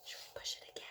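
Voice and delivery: mischievous unvoiced whisper. Teacher tempting kids to push again, whispering.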